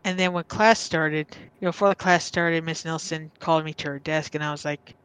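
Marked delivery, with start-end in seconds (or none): none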